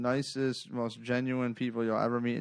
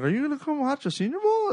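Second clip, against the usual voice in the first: high, quick voice of a friend; imitating eager friend; 14 semitones higher, faster than usual. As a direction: mimic a buddy's invitation, high and fast. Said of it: "He's like".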